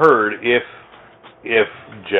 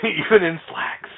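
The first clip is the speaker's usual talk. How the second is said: laughing; 7 semitones higher, slower than usual. Real, not acted. Punchline to a joke.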